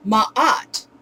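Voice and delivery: Ma'at in two parts with a catch between; Ma'at, broken by a glottal stop. Shown as a demonstration. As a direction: say Ma'at as mah-at, throat closed between vowels. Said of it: "Put that together".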